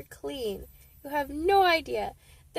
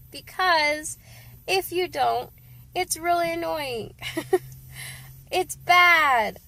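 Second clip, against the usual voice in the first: ordinary talk, nothing marked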